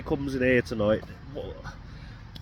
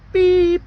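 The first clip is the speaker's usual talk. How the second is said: high loud bleep sound; censor beep; 11 dB louder than usual. Pretend. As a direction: bleep out the swear word. Self-censoring a swear word.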